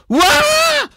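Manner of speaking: high, sudden scream of fright; shrieking scream. Acted. Startled in the dark by something leaping at her, shrill scream. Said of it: "Kreischend falle ich".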